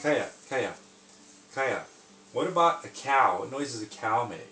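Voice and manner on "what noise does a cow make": normal talking voice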